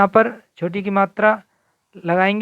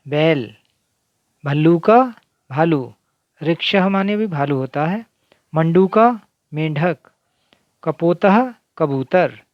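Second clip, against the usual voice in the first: usual speaking voice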